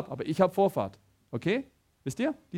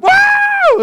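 much higher, much louder startled scream; startled yell; 16 dB louder than usual. Retold or imitated. Friend in passenger seat screams at crash, startled yell. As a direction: shriek at the near crash. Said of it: "schreit der Philipp".

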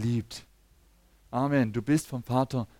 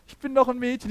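put-on higher little-girl voice, insisting; daughter's indignant reply; 10 semitones higher, 4 dB louder than usual. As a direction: play the little girl protesting that she is a girl. Daughter voiced in family anecdote. (hohe Mädchenstimme, beleidigt)

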